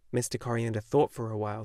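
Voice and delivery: plain reading voice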